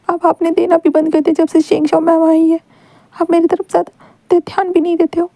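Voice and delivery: put-on jealous boy crying to his teacher; tearful outpouring. A narrator's reading. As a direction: pour out the hurt through tears.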